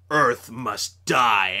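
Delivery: low, slow, drawn-out villain line; Optimus Prime. Retold or imitated. Quoting Optimus Prime's evil threat, low and slow. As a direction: intone it low and very slowly. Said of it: "he said something like".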